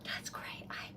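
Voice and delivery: hushed whisper. Real, not acted. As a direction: whisper in awe.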